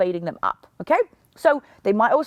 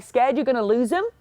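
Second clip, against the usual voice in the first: ordinary delivery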